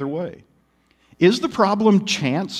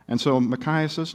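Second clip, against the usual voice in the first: ordinary talk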